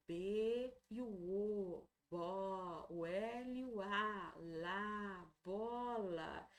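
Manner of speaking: drawn-out syllables, each set apart; slow dictation. Shown as a demonstration. Teacher dictating bola to a child, slow and drawn out. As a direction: spell it out slowly, letter by letter. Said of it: "você ir ditando pra ele".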